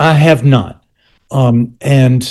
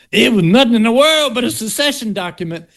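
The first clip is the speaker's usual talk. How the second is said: amped-up Southern accent; 9 semitones higher than usual. Shown as a demonstration. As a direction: lay the Southern accent on thick, old-man style. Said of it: "I kind of amp up my Southern accent".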